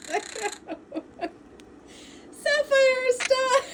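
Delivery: high loud pleading through laughter; laughing pleading. Real, not acted. Owner pleading with her parrot for her glasses, laughing. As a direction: beg for the glasses back, laughing. Tug of war over glasses.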